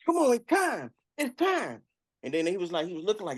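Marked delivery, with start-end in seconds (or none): 0.0-1.8 high, singled-out urging call; calling through door. Acted. Orderly knocking on the bathroom door, high insistent call. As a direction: summon someone in a raised pitch.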